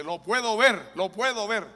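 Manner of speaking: high, loud cry of joy; joyful cry. Retold or imitated. Healed woman crying to the pastor, high joyful shout. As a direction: shout it out like she can finally see. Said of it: "Y me dice".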